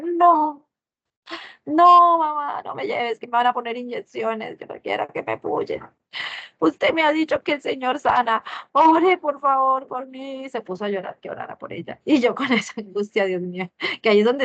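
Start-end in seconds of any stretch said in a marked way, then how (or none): 0.0-10.4 put-on high, pleading child's cry, louder at first; imitating crying child. Mother retells daughter begging her through tears. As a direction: plead like a crying little girl.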